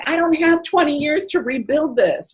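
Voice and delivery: high, pleading objection; exasperated protest. Retold or imitated. Protesting to the voice about rebuilding wealth, high exasperation. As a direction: push back hard, out of patience. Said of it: "'Cause I'm like".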